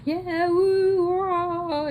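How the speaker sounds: high, drawn-out whooping; imitated high party cheer; drunken cheering. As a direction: whoop it up like partying jet skiers. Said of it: "and they're going".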